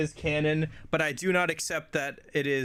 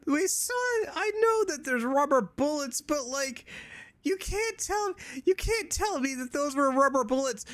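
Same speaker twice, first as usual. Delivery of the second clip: incredulous; 8 semitones higher than usual. Real, not acted. Incredulous rant about the plot.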